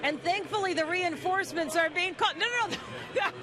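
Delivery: high-pitched, giggling through the words; cracking up. Real, not acted. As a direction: try to report while breaking into laughter. Interviewer cracks up during pie prank.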